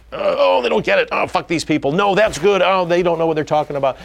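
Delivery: high-pitched mock griping; defensive indignation. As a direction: whine like a wounded director rejecting criticism. Acting out defensive director at screening.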